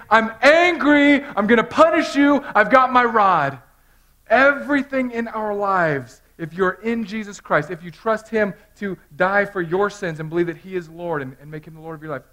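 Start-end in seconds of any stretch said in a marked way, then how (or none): 0.1-3.6 mock angry God. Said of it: "God is saying". 4.3-11.2 loud, high impassioned sermon; full-force preaching. Acted.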